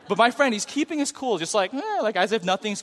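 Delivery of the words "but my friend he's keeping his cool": normal talking voice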